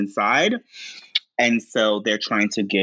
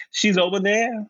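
lightly raised voice pointing someone out; a casual pointer, pitched up; voicing a queen; 12 semitones higher than usual. Queen pointing Noah to LaChance, lightly voiced. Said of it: "And she says".

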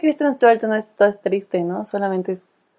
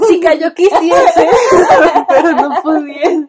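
slow, higher, louder mock yell through laughs; laughing mock shout; 7 semitones higher, 4 dB louder, slower than usual. Fan yelling at Bella on screen, laughing mock shout. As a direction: shout it out playfully, stretching the words. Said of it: "hubiera gritado así de".

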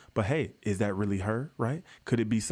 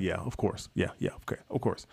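sarcastic mutter; 4 semitones lower, 4 dB quieter than usual. Retold or imitated. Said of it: "I'm like".

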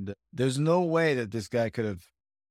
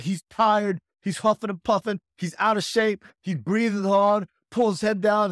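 higher, louder sideline shouting; coach calling out; 7 semitones higher, 5 dB louder, slower than usual. Retold or imitated. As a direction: call the weakness out to your fighter. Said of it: "the coach, like".

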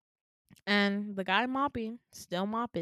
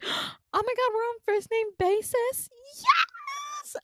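very high gushing squeal, rising to a shriek; mock squealing delight; 4 dB louder, slower than usual. Nurse thrilled at first-name basis, high squealing delight. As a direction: imitate an overexcited nurse, shrieking with joy.

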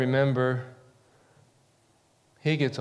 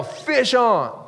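calling out; 8 semitones higher than usual. Shown as a demonstration. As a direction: call it out to the river. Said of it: "But you gotta yell".

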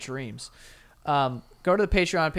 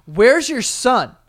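loud, higher, drawn-out demand; retold angry demand, raised voice; storming-in sister; 6 semitones higher, 8 dB louder, much slower than usual. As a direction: burst in demanding an answer. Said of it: "my sister comes storming in".

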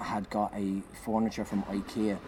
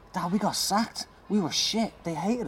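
high despairing plea; 10 semitones higher, slower than usual. Retold or imitated. Telling dad, high pitched.

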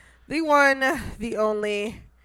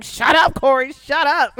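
imitating friend; 3 semitones higher, 6 dB louder than usual. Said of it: "my friend is sitting there, like, going".

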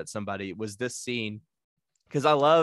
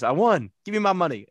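louder then higher, demanding payback; imitating Smokey; 6 semitones higher, 4 dB louder, faster than usual. Friend quoting Smokey demanding his dice money, pitched up. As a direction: insist on getting paid, pitched up. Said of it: "Smokey goes".

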